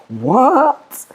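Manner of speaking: high, stretched disbelief; shocked squeak of disbelief; incredulous what. Retold or imitated. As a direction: sound totally thrown. Reacting to the friend's compliment.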